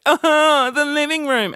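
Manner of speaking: a hammed-up sob over the room; fake crying Trish. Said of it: "put on the Trish wig and be like".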